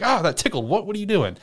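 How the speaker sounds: high-pitched mock protest; startled. Shadow reacting to being poked by him, startled.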